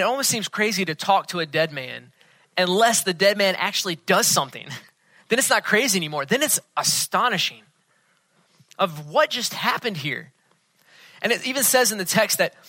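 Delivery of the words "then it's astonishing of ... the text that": normal talking voice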